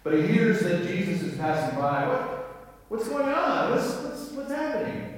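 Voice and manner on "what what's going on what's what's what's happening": high, urgent questioning; flustered beggar asking what's going on; mock-frantic, much higher; confused blind man. Blind man voiced in story.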